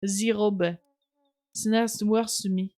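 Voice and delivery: mock French accent, "The" as "zi", throaty R in "robe". Shown as a demonstration. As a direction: redo the line in a thicker French accent. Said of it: "Not French enough".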